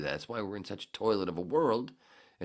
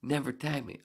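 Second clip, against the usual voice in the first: ordinary talk, nothing marked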